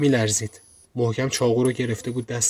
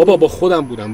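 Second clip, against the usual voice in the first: louder, higher panicked cry; panicked shout; 5 semitones higher, 7 dB louder than usual. Acted. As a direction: yell it to save yourself. Panicked shout under threat.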